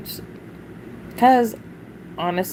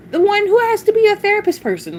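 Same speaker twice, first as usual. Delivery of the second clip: much higher, louder, fed-up rant; exasperated outburst; 4 dB louder than usual. Real, not acted. Fed-up streamer venting about comforting others. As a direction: burst out with frustration.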